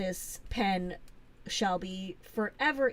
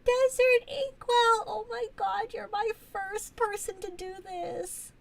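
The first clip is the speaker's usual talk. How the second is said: high, loud, thrilled squealing thanks; overjoyed squeal; 13 semitones higher, 6 dB louder than usual. Real, not acted. Streamer squealing thanks to a viewer for a surprise gift. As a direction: gush with delight over a surprise gift.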